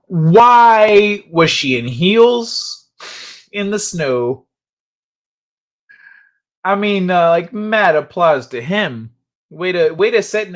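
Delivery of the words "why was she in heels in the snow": loud, long drawn-out "Why"; loud incredulity. Real, not acted. Streamer reacting to heels in the snow, loudly incredulous. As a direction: stretch the question out in loud disbelief.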